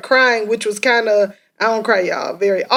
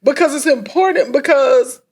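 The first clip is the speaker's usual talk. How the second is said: higher, tearful voice fighting back tears; crying; 4 semitones higher than usual. Real, not acted. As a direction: speak through real tears. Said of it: "I'm trying to preserve this makeup".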